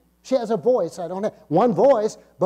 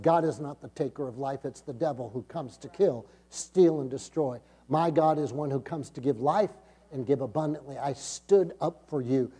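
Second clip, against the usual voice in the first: hushed, lower, earnest prayer; prayer to Abba; 4 semitones lower, 3 dB quieter than usual. Acted. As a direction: address God intimately, softly recounting your faithfulness. Prays aloud to Abba.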